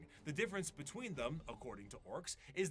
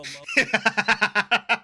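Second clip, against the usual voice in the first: bursting into laughter mid-sentence; laughing; 9 semitones higher, 21 dB louder than usual. Real, not acted. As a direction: laugh through the words. Laughing mid-sentence at orc stealth.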